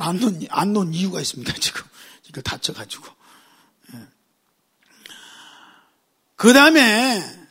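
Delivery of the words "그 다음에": slow, loud, stretched preacher call; drawn-out preaching. Acted. Pastor to congregation, stretched loud call. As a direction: hold the phrase out to the crowd.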